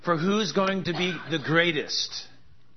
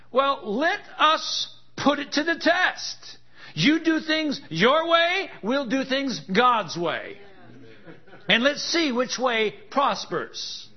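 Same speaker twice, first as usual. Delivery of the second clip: higher, louder, slow ringing challenge; preaching at full force; 10 semitones higher, 3 dB louder, slower than usual. Acted. Preacher challenges doubters to test God's way, full force. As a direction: throw down the gauntlet from the pulpit.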